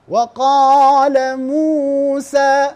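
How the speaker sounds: وقال موسى with the final a of قال drawn out into a long alif; وقال موسى, drag the qa of وقال into a long aa; stretched recitation. Shown as a demonstration. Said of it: "هذا خطأ".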